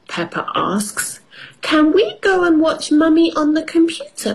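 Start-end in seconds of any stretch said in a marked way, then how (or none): 1.6-4.3 put-on high little girl voice; child voice. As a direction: squeak it like a small piglet. Peppa voiced in story.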